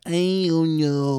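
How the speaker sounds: put-on very slow, high childish voice; cartoon baby talk. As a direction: drag it out like a toddler. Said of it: "Why are you talking like that?".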